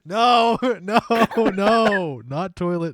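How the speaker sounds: high, loud protest through laughter; laughing so hard the words squeak out. Real, not acted. Cracking up at the joke.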